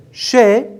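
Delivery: "chez" cut off before the z sound; isolated first syllable. Shown as a demonstration. Teacher showing pupils a first syllable, "chez" said alone. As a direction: say just the opening syllable "chez", stressed. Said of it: "ça c'est la première syllabe".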